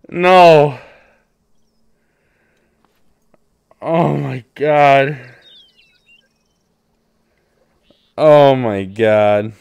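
very loud, drawn-out slow groaning words; anguished cry. Real, not acted. Player realizing he blew the hunt, crying out in loud dismay. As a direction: wail over the loss. Missed shot, despair.

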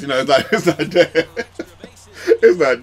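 loud laugh breaking up the words; amused, restarting through laughter. Real, not acted. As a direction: laugh it off mid-sentence. Laughs off how seriously he plays.

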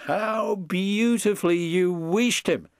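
high, amused admiration; lightly amused, pitched well up; laughing. A narrator's reading. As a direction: praise with a laugh in your voice. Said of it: "laughed Edward".

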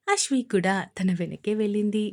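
ordinary delivery

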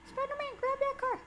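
very high, quick plea; urgent squeal. Real, not acted. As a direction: squeak out a hurried order. Urging the character on.

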